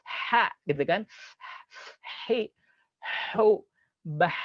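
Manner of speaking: throaty ha hi hu ba; breathy ha drill. Shown as a demonstration. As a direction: breathe each ha hi hu out. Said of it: "untuk melatih huruf ha".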